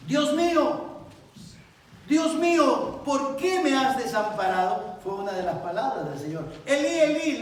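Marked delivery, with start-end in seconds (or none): 0.1-5.0 high, loud cry to God; anguished loud cry. Acted. Preacher voicing the psalmist forsaken, crying loud. 6.6-7.4 high, loud "Elí, Elí"; loud cry. Acted. Christ's cry from the cross, loud.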